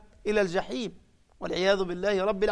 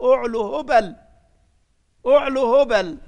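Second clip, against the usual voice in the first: louder and higher triumphant shout, climbing on the second call; loud boast; 4 semitones higher, 8 dB louder than usual. Retold or imitated. Abu Sufyan boasting to the Muslims, loud triumphant shout. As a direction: shout the idol's glory in triumph. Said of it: "فرفع صوته وقال".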